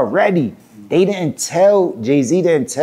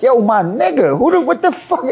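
high-pitched indignant outburst; retold angry challenge, pitch climbing; getting mad; 10 semitones higher than usual. Rapper turning on his idol, indignant and heated. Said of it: "now I'm getting mad".

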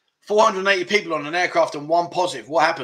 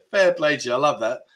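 ordinary talk, nothing marked

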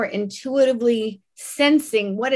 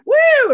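high, loud "woo"; playful whoop; 9 dB louder than usual. Acted. Comic skipping past red flags, carefree whoop. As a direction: whoop it out as you leap over trouble.

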